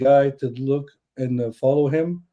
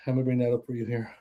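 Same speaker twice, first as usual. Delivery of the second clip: normal talking voice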